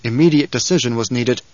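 ordinary delivery

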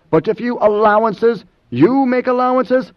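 high-pitched, outraged question; incredulous echo. Acted. Moneo repeats Idaho's words back at him, incredulous. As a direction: throw it back with pitch soaring on YOU.

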